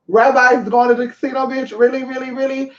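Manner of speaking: high, disbelieving questions; incredulous reaction. Retold or imitated. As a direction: repeat it in mock disbelief. Acts her incredulous reaction.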